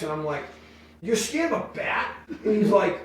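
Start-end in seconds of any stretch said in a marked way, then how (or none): 1.0-2.1 high disbelieving question; can't believe the tough guy is scared; high incredulous teasing. Retold or imitated. Mocking the hockey player.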